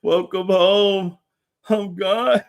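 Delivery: slow, high mocking [name] voice. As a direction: drawl it out slow and high as if leaving. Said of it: "He was like".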